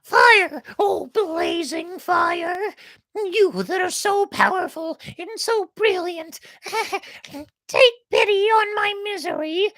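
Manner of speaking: very high, loud, pleading chick; desperate plea. A narrator's reading. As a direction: beg the fire for mercy.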